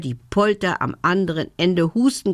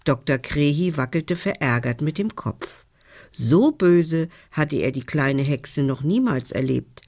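plain reading voice

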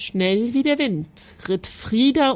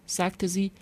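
plain reading voice

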